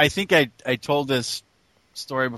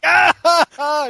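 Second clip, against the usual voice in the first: mock laughter; 10 semitones higher, 4 dB louder than usual. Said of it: "And I started laughing".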